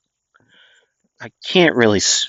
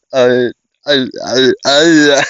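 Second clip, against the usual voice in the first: put-on childish voice demo; 3 semitones higher, 4 dB louder, slower than usual. Shown as a demonstration. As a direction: lightly try out her squeaky voice. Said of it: "I made her sound like that".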